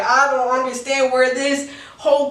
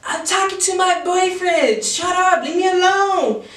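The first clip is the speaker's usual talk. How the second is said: mimicking her in a higher, whiny voice; high mock girl; 8 semitones higher than usual. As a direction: play her snapping at him in a high pitch. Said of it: "And she's all like".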